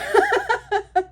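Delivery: high loud laugh; burst of laughter. Real, not acted. She laughs at her own joke, real high laugh.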